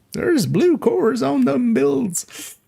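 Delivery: put-on high, slow old prospector voice. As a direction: croak it like a gold-rush prospector. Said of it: "we went with the old prospector voice".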